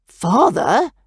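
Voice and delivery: shocked. A narrator's reading.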